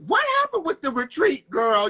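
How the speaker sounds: ordinary talk, nothing marked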